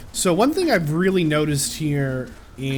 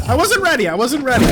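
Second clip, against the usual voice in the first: high, louder panicked protest; 9 semitones higher, 5 dB louder, faster than usual. Real, not acted. Gamer dying suddenly, protesting high and loud. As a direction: plead in panic.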